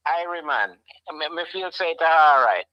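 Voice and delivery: a light Jamaican patois sign-off; Jamaican accent. Pretend. Dad gives a light Jamaican goodbye to his son. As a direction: say it easy, Jamaican style. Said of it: "in a Jamaican way".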